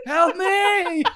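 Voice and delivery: high laughing mock cry for help; stuck in a burning seat, hammed-up pleading. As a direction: wail for help while cracking up.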